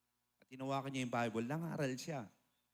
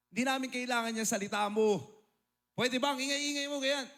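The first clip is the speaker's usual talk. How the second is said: much higher and louder scolding shout; angry shout; 10 semitones higher, 6 dB louder than usual. Retold or imitated. Furious aunt shouting down the preacher, high and loud. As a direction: tell him off loudly and shrilly.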